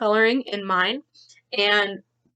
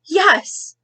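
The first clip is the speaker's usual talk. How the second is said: high, loud burst of excitement; excited yes; 8 semitones higher, 5 dB louder than usual. A narrator's reading. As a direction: shout it like big news. Cloud bursts in story.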